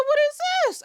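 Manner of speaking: very high, loud shriek of disbelief; high-pitched, hammed-up outrage; incredulous outcry. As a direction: squeal it in exaggerated shock. Acted incredulous reaction to the film.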